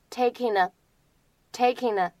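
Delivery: "taking a" slowly joined as taking-nuh; linked pronunciation. Shown as a demonstration. Teacher showing students linking, "taking a" slowly run together. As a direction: link "taking a" into one word, no gap before the a. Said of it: "可以做连读".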